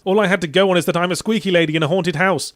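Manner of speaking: usual speaking voice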